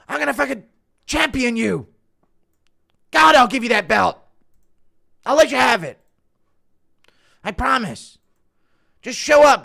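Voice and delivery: high, loud fighter pleading rant; Colby Leftist. Retold or imitated. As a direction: rant like a hyped fighter begging a rival. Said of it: "Colby Leftist impression?".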